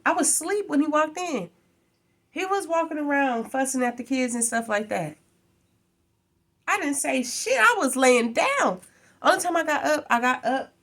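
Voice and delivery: indignant. Real, not acted. Telling viewers about her boyfriend, loud indignant protest. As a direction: defend yourself, voice rising. Heated retelling of the fight.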